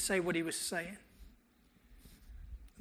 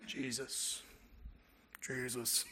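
lower, deep slow rumble of the name; 6 semitones lower, 7 dB quieter, much slower than usual. Retold or imitated. Mute boy's first words, imitated low and deep. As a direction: drop the voice down deep like a soul crooner.

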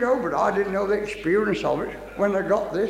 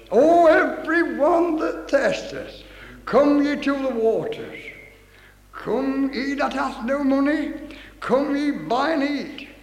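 slow, raised preaching voice; scripture proclamation; 4 semitones higher, slower than usual. Real, not acted. Preacher reads Isaiah to the congregation, slow proclamation. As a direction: call it out slowly, stretching the phrases.

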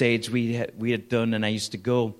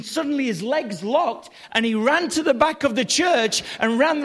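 high, excited testimony preaching; preacher carried away by the story; impassioned preaching; 8 semitones higher, 4 dB louder than usual. Real, not acted. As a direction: build the miracle story with rising fervour. Preaches the miracle story at full force.

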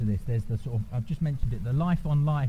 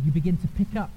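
ordinary talk

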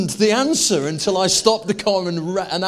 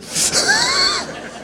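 high shrieking laugh. Real, not acted. After wife's reaction.

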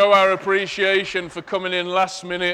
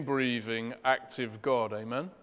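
normal talking voice

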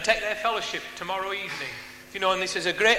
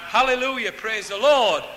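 loud, raised preacher's acclamation; exclaimed praise; 2 semitones higher, 5 dB louder than usual. Real, not acted. Preacher celebrating the prisoner set free, loud exclaimed praise. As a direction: proclaim it loudly to the congregation.

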